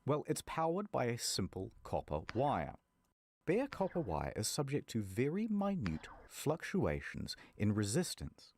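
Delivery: ordinary talk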